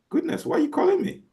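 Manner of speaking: startled, annoyed late-night answer; annoyed surprise at 2 AM. Shown as a demonstration. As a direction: woken up and indignant. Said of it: "I'm gonna say".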